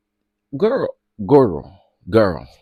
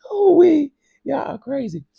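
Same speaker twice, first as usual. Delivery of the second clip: laughing; 4 dB louder than usual. Real, not acted. Laughing outburst.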